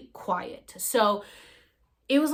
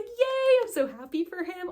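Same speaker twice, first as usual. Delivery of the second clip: high, bright yay that settles; excited cheer; 11 semitones higher, 4 dB louder than usual. Retold or imitated. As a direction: cheer for him like a proud friend. Cheering for him.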